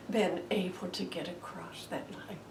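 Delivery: whispered, unvoiced and soft; hushed whisper. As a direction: drop to a reverent whisper. Awed climax of the sermon.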